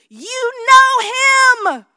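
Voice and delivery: high, loud, slow shouted proclamation; sudden piercing scream across the classroom; screaming teacher. Retold or imitated. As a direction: scream it like a fired-up worship leader. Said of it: "she would just start screaming".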